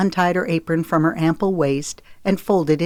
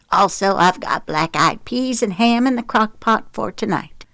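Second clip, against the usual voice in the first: higher-pitched Southern woman; Gina, bustling; 6 semitones higher than usual. Acted. Gina tells the family about tonight's supper, bustling. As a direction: list it off in a high, folksy voice. Narrator voices Gina listing food.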